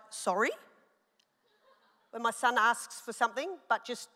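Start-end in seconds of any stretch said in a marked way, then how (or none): 0.1-0.5 stern sorry. Shown as a demonstration. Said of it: "what different tones of my voice mean".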